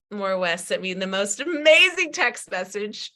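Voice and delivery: usual speaking voice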